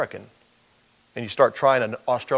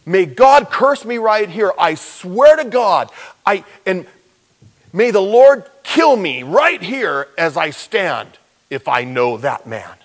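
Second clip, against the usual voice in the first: loud, slow, higher desperate swearing; ranting oath; 6 semitones higher, 7 dB louder, slower than usual. Acted. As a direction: swear it at full volume, slowly, desperate to be believed. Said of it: "while he was ranting and raving".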